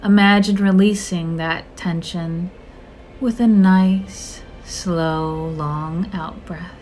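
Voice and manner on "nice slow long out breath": slow exhale cue. Acted. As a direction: stretch each word like a long breath out. Models a long exhale.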